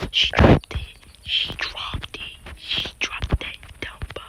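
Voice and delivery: real hushed, voiceless whisper. Real, not acted. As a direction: whisper it under your breath in disbelief. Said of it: "why am I whispering".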